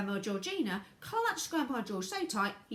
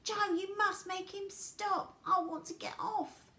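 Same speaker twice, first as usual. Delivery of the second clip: high, pleading acted yell; 13 semitones higher, 4 dB louder than usual. A narrator's reading. Grandma Josephine begs Joe for help, yelling high. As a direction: beg to be saved at a high pitch. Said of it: "yelled Grandma Josephine".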